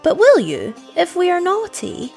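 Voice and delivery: put-on high, loud childish plea; anxious question, climbing high; despairing. A narrator's reading. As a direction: beg as desperate children. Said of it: "they asked in despair".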